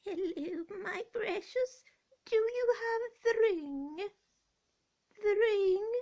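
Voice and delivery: high, slow, creeping Gollum voice; Gollum impression. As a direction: purr it out like Gollum craving his ring. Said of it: "let me do my impression".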